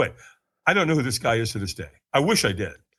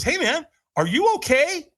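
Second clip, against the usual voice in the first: higher-pitched imitation of a passer-by checking in; concerned stranger; 13 semitones higher than usual. Stranger checks on the man he's staring at, imitated concern. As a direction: call over a retold, curious question.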